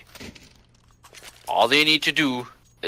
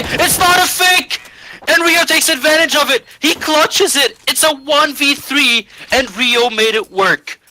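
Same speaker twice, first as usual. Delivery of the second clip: esports caster; 12 semitones higher, 5 dB louder than usual. Real, not acted. Clutch won, caster erupts.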